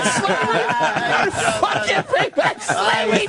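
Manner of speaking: laughing. Real, not acted. Cracking up at Pat's remark.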